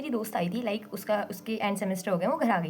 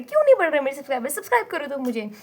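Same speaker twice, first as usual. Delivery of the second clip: loud, higher-pitched playful complaint; mock exasperation; 5 semitones higher, 7 dB louder than usual. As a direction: demand it in mock frustration. Mock complaint to viewers about subscribers. (झूठ-मूठ की झुंझलाहट में ऊँची आवाज़)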